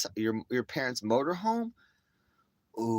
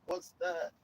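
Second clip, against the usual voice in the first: put-on innocent kid; 7 semitones higher, 5 dB quieter than usual. Kid not knowing word instigator.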